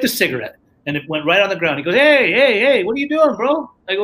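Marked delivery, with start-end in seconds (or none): 1.9-3.7 loud, high call of hey, hey, hey. Retold or imitated. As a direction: stop him mid-flick with a sharp call. Counselor calling out littering.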